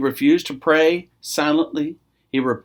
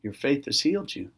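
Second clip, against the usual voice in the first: gentle Jesus; 3 semitones lower, 9 dB quieter than usual. Acted. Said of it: "Jesus saying".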